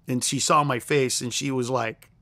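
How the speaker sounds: ordinary talk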